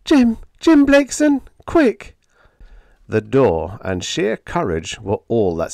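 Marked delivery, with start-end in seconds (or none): 0.0-2.2 high, loud frightened cry for help. A narrator's reading. Helen at the door crying for Jim in fright. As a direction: shriek his name in fright at the door. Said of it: "now high-pitched in fright, cried".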